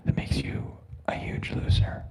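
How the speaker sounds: hushed mocking jab at the chat; whispered taunt. Taunting chat after calling-out.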